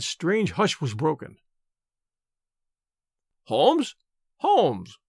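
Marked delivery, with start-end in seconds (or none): 3.4-5.0 insistent call to rouse a sleeper; calling a name to wake someone. A narrator's reading. Visitor waking a sick man, insistent. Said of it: "in the insistent tone of one who awakens a sleeper".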